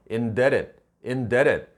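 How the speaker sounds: "Indebted" said as in-DET-ed, no B; "Indebted" with silent b and stressed middle syllable. Shown as a demonstration. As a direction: drop the B in "Indebted" entirely. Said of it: "B 철자가 탈락이 돼갖고요".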